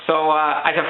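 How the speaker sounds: ordinary talk, nothing marked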